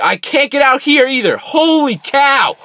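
high, loud, dragged-out cry of disbelief; exasperated yell. Real, not acted. Stuck player yelling at the game, high and loud. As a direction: let the frustration burst out.

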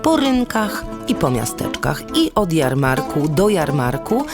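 ordinary delivery

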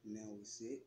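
soft meditation voice, long pauses between phrases; guided meditation. Acted. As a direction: guide them gently into relaxation, leaving space to breathe.

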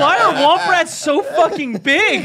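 high, loud, drawn-out incredulous yell; comic bellowed complaint; mock outrage. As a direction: demand it slowly in exasperated disbelief. Joins mock pilots' outrage.

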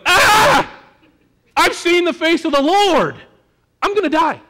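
high, loud terrified cry; panicked shout. Shown as a demonstration. Gideon panicking after seeing the Lord, terrified shout. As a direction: shriek it like you're about to die. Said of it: "it would be something more like".

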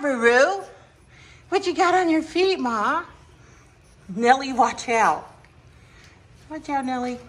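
high, sing-song voice for the dog; talking sweetly to a little dog. Real, not acted. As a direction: coo at the puppy. Baby talk to dogs.

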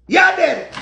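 high, loud flare-up; short loud exclamation of rage; angry outburst. Real, not acted. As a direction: burst out in mock fury.

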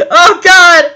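comic exclamation of dread; dismayed. Real, not acted. Reader dismayed after laughing.

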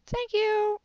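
high, soft squeaky thanks; silly high thanks. Acted. Thanking viewers at the end, high squeaky.

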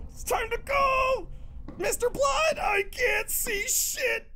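high, drawn-out panicky whine; nervous high wail. Real, not acted. Player forcing himself onward unable to see, high nervous wail. Player forces self forward, scared.

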